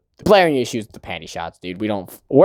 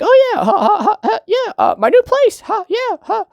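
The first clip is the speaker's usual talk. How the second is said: high, forced nervous laughter between words; squeaky, awkward fake ha-ha-ha while making excuses; flustered cover-up. Boy covering up the dropped key, flustered.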